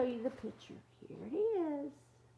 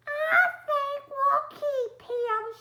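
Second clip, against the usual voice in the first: high, loud, slow fond decision; Missus Monster; 11 dB louder than usual. Acted. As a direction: say it like a proud monster mum. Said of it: "she said".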